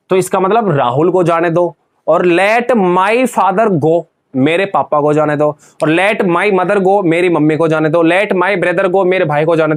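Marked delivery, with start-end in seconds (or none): none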